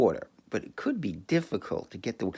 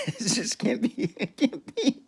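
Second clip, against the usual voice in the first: laughing; 13 semitones higher, slower than usual. Real, not acted. Laughing in disbelief.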